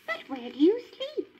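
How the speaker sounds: Lady's questioning voice. A narrator's reading. Lady asks the Tramp where he sleeps, high and curious. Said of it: "Lady asked him".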